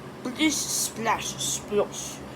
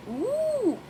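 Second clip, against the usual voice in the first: high excited ooh; excited gasp; 13 semitones higher than usual. A narrator's reading. Peppa amazed by the garden, high excited ooh. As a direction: gasp with delight. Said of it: "gasped Peppa excitedly".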